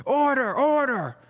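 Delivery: high, drawn-out courtroom shout; loud, insistent judge's cry; judge calling order. Acted. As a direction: bellow for order over the gavel. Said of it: "you hear the gavel going".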